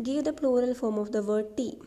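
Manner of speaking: slow, deliberate exam question read-out; slow dictation reading. Shown as a demonstration. Teacher reading a PSC question aloud slowly. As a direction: read each word slowly for the class.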